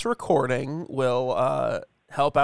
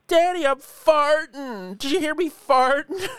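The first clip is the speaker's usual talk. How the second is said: put-on little girl voice; 12 semitones higher, 4 dB louder than usual. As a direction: squeal it like a proud toddler. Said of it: "just goes".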